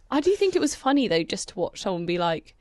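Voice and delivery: ordinary talk, nothing marked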